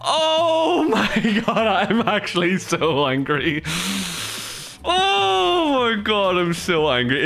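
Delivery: high, drawn-out wails of fury cracking into laughs; laughing rage. Real, not acted. Streamer raging at dying to a fusion coil, high laughing howls. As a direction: stretch each oh my god into a howl.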